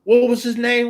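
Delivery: imitating a fan. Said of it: "I'm like".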